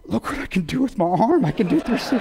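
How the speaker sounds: high, excited show-off voice imitating Oz; gleeful Oz. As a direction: play the wounded buddy proud of his floppy arm. Oz showing off his limp arm.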